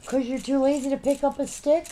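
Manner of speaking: ordinary talk, nothing marked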